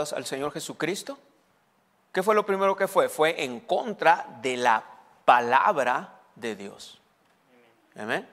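usual speaking voice

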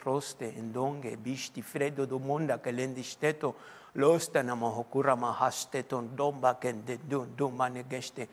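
low, soft stream of tongues; praying in tongues. Shown as a demonstration. Pastor showing how he prays when words run out, soft tongues. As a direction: murmur steady glossolalia under your voice. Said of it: "empiezo a orar en lenguas".

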